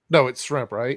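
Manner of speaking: ordinary talk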